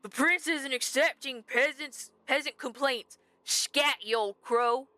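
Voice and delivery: guard voice. Acted. Guard dismissing an old crow at the gate, officious guard voice. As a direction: turn away a peasant like a guard. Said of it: "I'm good at these guard voices".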